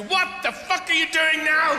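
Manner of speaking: family shouting. Retold or imitated. Fed-up family yelling at dad in the kitchen: mock angry shout.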